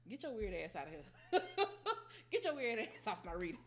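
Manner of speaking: laughing mock scold.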